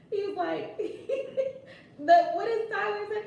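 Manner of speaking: words broken by high giggles; laughing. Real, not acted. Host trying to recall Tyler's line, laughing. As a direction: talk through the laughter.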